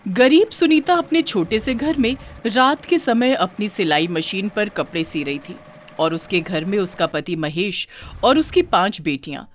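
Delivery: usual speaking voice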